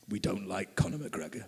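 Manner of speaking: low, soft, menacing guard impression; imitating the huge guard. Huge border guard telling the Irishman he dislikes McGregor, low and soft mock menace. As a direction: drop the voice low and quiet like a giant guard.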